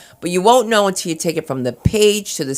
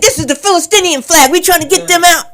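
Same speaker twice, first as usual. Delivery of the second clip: high, loud excited declaration; heated shouting; 11 semitones higher, 5 dB louder than usual. Acted. Protester explaining the flag he carries, heated shouting. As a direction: holler it high and defiant. Acted street argument being retold.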